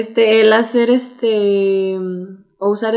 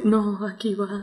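mimicked hesitation; 2 semitones lower, 12 dB quieter than usual. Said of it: "es así como de".

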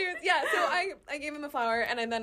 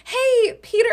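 bright, loud, nervous hello pitched way up; nervous high greeting; 14 semitones higher, 9 dB louder, slower than usual. Retold or imitated. Greeting her crush at the gym, nervous high call. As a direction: blurt his name out on a jittery high note.

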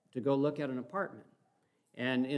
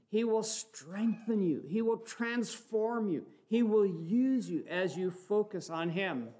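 preaching at full force; 7 semitones higher, slower than usual. Acted. Preacher exhorting brothers and sisters, fervent preaching. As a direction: rouse the church to look to Jesus. Leads a preaching call to congregation.

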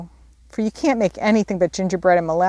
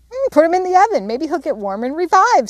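put-on high, bright little-girl hope; a small sister's lightly voiced eager idea; hopeful; 10 semitones higher, 4 dB louder than usual. A narrator's reading. Amy hopefully suggesting the oven to revive Pip, high and eager. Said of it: "said Amy hopefully".

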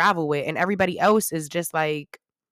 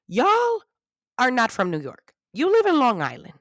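high, emphatic playful correction; mock indignation; 6 semitones higher than usual. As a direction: set them straight with hammed-up outrage. Mock correcting Long Islanders.

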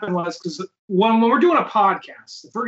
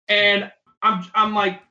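ordinary talk

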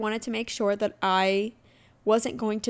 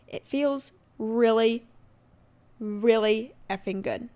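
slow, drawn-out stress on "really, really effing good"; emphatic; slower than usual. Real, not acted. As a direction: lean hard into each "really". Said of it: "I'm emphasizing".

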